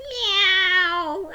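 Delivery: loud, very high frightened meow; a startled kitten's cry; scared kitten. Acted. The scared kitty jumping from Prabhu's hand, high loud meow.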